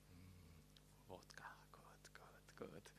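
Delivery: breathy whispered delight over the drink; savoring whisper. Retold or imitated. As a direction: whisper it like tasting something precious. Man savouring river vodka.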